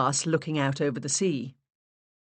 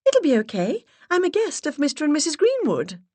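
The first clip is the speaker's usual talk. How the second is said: put-on higher and louder, stronger accent; high breathy put-on voice; 12 semitones higher, 5 dB louder than usual. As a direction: voice raised an octave, stronger English accent. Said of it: "I raised my voice an octave".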